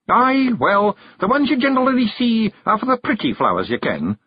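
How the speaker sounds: high, improvising fairy voice; high-pitched fairy talking his way out; desperately inventing. A narrator's reading. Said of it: "inventing desperately".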